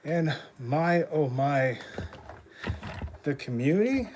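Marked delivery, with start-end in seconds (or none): none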